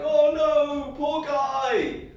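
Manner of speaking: high, loud mock pity; a hammed-up God pitying a drowning man; God's pity. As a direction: cry out in hammed-up sympathy. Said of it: "and God's like".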